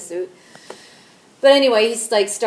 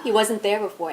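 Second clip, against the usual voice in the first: usual speaking voice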